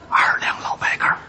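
hushed customer's order; furtive, breath-only voice; secretive whisper. Pretend. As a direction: whisper it like a password. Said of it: "小声嘀咕着说".

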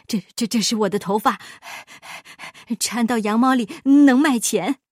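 sputtering, fast retort; huffy miser defending himself; angry. A narrator's reading. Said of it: "巴依老爷生气地说".

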